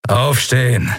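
curt command to stand up; a touch of a harsh guard barking at her; barked order. Acted. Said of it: "herrschte er sie an".

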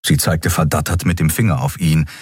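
ordinary delivery

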